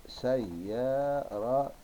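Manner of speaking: سيارة very slow, in parts say-ya-ra-tun; drawn-out, separated syllables of سيارة. Shown as a demonstration. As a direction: spell out سيارة sound by sound. Same word broken into syllables.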